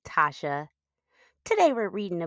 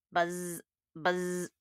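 quiet buzz; 7 dB quieter than usual. Acted. Reading to kids, a soft buzzing bee noise. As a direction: hum it like a pesky bee. Imitating bad insect sounds.

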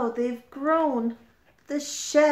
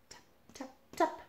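whispered tapping; 7 semitones higher, 8 dB quieter, much faster than usual. A narrator's reading. Reader whispers the crab's tapping. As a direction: tap tap whispered softly.

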